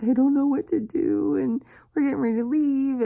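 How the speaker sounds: high, panicky mock wail; retold bawling. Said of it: "I was bawling".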